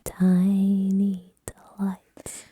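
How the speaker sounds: drawn-out breathy whisper; slow whisper. Pretend. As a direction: whisper it very slowly.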